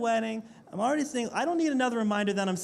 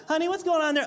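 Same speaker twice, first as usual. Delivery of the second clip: higher wife's call from another room; 7 semitones higher, 3 dB louder, faster than usual. Retold or imitated. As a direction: call in like a curious wife. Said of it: "start asking questions".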